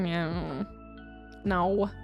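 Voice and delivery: usual speaking voice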